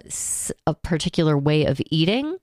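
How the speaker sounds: ordinary talk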